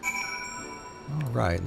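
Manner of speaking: ordinary talk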